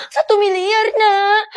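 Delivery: loud, high tearful wail; hammed-up crying, high and loud; wailed lament. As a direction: bewail a huge loss to your child. Mother laments stolen billion.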